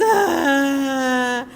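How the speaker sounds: loud mock child's cry, dry-throated; a toddler's loud put-on sob; imitated child's wail. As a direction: wail like a tired crying little girl. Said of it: "e a menina chorando".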